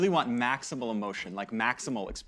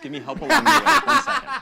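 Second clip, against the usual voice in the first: laughing; 11 dB louder than usual. Real, not acted. Laughing while correcting the model.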